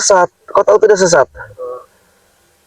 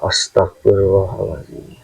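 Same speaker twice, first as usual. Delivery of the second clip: low prayer murmur; 11 semitones lower, 8 dB quieter, slower than usual. Real, not acted. Exorcist's low prayer formula.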